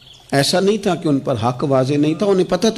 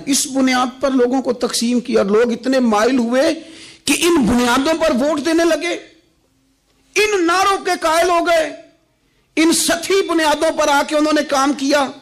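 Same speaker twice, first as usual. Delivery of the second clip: high-pitched sermon denunciation; fervent preaching; 11 semitones higher than usual. Acted. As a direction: pitch it high and press every phrase. Preacher denounces voting habits.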